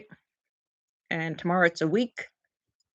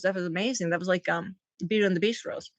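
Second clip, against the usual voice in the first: normal talking voice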